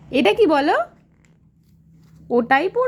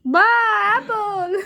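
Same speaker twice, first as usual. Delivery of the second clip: delighted praise; 7 semitones higher, slower than usual. Real, not acted. Toddler says apple, mother delighted.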